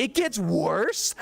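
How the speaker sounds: slow, rising, incredulous "It gets worse?" with "gets" stretched high; disbelief. Retold or imitated. Coworker hearing the worst scene is still ahead, slow stunned question. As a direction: coworker can't believe it, drawn out, pitch climbing. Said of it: "And he's like, he's like".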